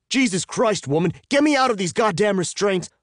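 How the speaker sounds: high, loud outraged yelling; furious shout. A narrator's reading. Bound captive demanding release from restraints, furious shout. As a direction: explode at your captor at the top of your voice.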